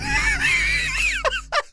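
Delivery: high, crying-out burst of laughter; shrieking laugh. Real, not acted. Player reacts to a cursed creature with a shrieking laugh. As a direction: let out a shrieking laugh of shock.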